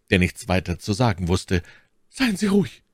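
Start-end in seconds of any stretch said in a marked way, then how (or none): 2.1-2.8 much higher, ominously soothing lady; calm him in a tone that chills; unsettling reassurance. Acted. My Lady soothes the count, ominous tone. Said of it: "in einem Tone, der für ihren Zuhörer wenig beruhigend war".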